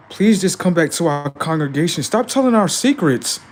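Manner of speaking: high, loud begging voice; hammed-up high plea to stop; mock pleading churchgoer. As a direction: whine like a caught congregation. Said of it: "They're like".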